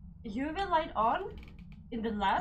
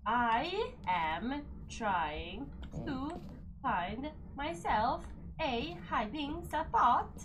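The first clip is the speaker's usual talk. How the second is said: very slow drawl; much slower than usual. Real, not acted. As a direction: stretch every word out very slowly. Drawn-out panicked hiding line.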